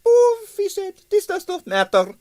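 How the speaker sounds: high, dismissive Russian impresario; lightly accented evasive impresario. A narrator's reading. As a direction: brush it aside nervously. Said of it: "shifted uneasily".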